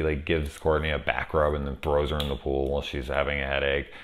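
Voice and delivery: ordinary delivery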